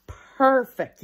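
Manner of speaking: loud, high praise; a proud teacher celebrating; delighted praise. A narrator's reading. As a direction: cheer her on.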